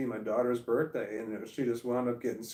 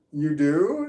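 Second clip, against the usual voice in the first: louder, startled you do; surprised; 4 semitones higher, 9 dB louder than usual. Retold or imitated. The daughter's friend reveals they talk boys, louder surprise. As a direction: genuinely caught off guard. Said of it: "And I'm like".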